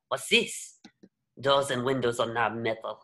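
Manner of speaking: ordinary delivery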